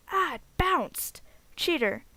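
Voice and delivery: loud, drawn-out protest at the game; excited outburst. Real, not acted. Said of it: "Getting so excited over this battle".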